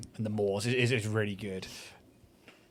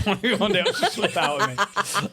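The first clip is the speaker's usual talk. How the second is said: slow, laughing, higher; 7 semitones higher, slower than usual. Shown as a demonstration. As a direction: repeat it slowly through laughter. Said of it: "That clip just there".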